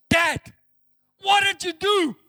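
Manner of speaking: put-on high, loud child's reproach; imitated little girl. Small daughter confronts her father at the gate, imitated high voice. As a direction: call out like an upset small daughter. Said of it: "five or six year old little girl".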